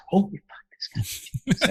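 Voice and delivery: hushed, breathy whisper of disbelief; stunned musicians whispering; awed whisper. Retold or imitated. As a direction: whisper it in awe.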